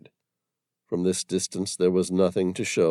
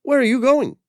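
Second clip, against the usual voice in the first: calling after him; 11 dB louder than usual. Acted. Calling after running man.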